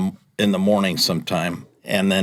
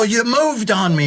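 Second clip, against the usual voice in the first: high, playful fake grievance; mock hurt complaint. Driver teases P J about leaving his route, high mock hurt.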